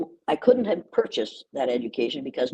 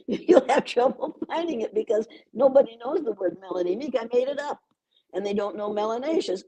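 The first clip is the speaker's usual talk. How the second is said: ordinary talk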